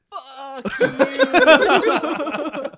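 loud, slowed-down yell; slow motion. Retold or imitated. Richie yelling in slow motion during rock fight. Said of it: "in slow motion going".

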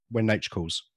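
ordinary delivery

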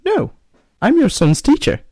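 high woman voice, loud; high put-on lady voice; man doing a woman's voice. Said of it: "So, woman, again".

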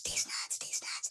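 whispered sprite chant getting fainter; fading whisper. Acted. Player voicing sprites as they fade away, whispering. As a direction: breathe it as they fly off.

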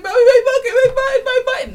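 high-pitched repetitive kid mimicry; mock whining child. Parent mimicking daughter deflecting about her button, mock whine.

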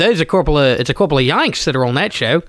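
mock Australian radio pitch; Australian accent. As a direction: play it as a true-blue Aussie. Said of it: "doing Australian accents".

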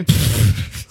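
loud breathy laugh; burst of laughter. Real, not acted. Laughs at the sketch.

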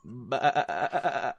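mock sheep bleat; stuttering "baa" in imitation; imitated bleat. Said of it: "that's what you said to me".

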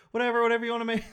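nervous. Retold or imitated. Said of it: "Jen was so scared".

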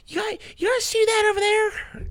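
put-on high, slow old lady voice; Italian grandma voice. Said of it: "I can't do, like, an Italian grandma, old person voice".

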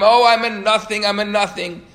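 high, loud wailing lament; mock scream. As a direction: keep crying it out loud. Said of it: "even screaming louder".

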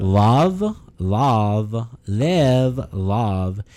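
drawn-out California "Love, love, live, love", tongue forward; California accent. Shown as a demonstration. Podcaster demonstrating a California accent, tongue forward on each L. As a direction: push the tongue in front of the teeth for a California accent. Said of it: "if you want that California accent, you gotta put your tongue in front".

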